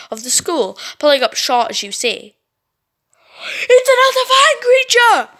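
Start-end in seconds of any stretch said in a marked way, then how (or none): 3.4-5.3 high loud yell; shrieked alarm. Acted. Brittany sees the creature and shrieks high and loud. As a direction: cry out in alarm. Spotting monster.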